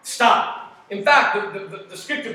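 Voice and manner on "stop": sharp stop. Acted. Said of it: "commanding halt".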